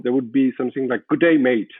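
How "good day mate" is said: trying an Australian accent. Shown as a demonstration. Guest tries an Australian greeting for the host, Australian accent. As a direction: greet like an Australian, slightly louder. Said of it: "I can try an Australian".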